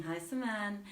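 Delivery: ordinary delivery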